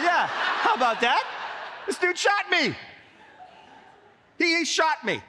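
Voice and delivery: high, exasperated retort; indignant vindication. Retold or imitated. Boy answering after the x-ray, indignant vindication. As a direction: throw it back, outraged and vindicated.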